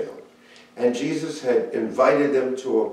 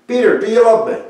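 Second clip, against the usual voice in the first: raised, pressing question; Jesus pressing Peter, raised and earnest; earnest plea; 10 semitones higher, 10 dB louder, faster than usual. Acted. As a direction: ask it high and urgent, pleading.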